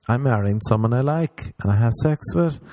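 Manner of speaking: normal talking voice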